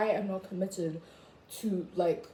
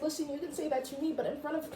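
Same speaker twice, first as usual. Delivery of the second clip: fast indignant protest; 8 semitones higher than usual. Retold or imitated. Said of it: "I was just like".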